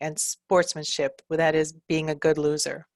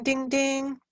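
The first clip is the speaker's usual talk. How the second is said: alarm ding; 8 semitones higher than usual. Shown as a demonstration. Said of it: "practicing sounds".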